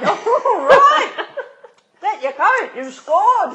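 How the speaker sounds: loud, high laughing cheer; laughing shout. Real, not acted. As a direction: whoop it out through laughter. Cracks up at the drunk's compliment.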